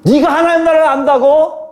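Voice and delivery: high, cutting rhetorical challenge; cold-hearted rebuff. Retold or imitated. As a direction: throw it back as a scornful question. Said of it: "되게 매몰차게".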